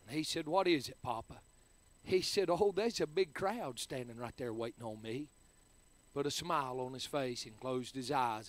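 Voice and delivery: hushed tender memory. Real, not acted. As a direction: speak gently, close to the mic, of a loved one's last words. Tender memory of his dying papa.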